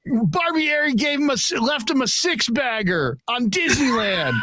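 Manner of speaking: high-pitched gleeful retelling; imitated excited announcement. Colleague announcing the six voicemails to his partner, high excited impression. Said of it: "he goes, he goes".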